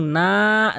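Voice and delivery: না held for three beats; stretched vowel. Shown as a demonstration. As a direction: draw the vowel of না out long. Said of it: "আমি একটু টেনে বলবো". (না তিন আলিফ টেনে)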